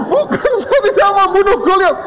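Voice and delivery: very high squealed denial; hammed-up terrified student; mock tearful panic. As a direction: plead innocence in a comic wail.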